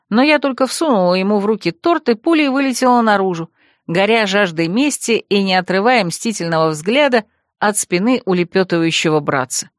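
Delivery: ordinary delivery, nothing marked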